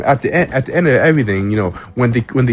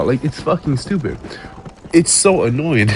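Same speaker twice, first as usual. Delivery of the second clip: normal talking voice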